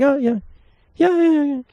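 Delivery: high, breezy mock agreeing; imitating himself faking that he heard her; fake agreement. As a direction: nod along without hearing a word. Pretending he heard the date.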